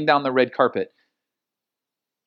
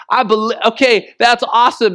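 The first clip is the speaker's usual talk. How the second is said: louder and higher, bursting with eager joy; eager excitement; 6 semitones higher, 6 dB louder than usual. Retold or imitated. New follower gushing back to Jesus, eager and excited. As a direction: gush like a brand-new believer.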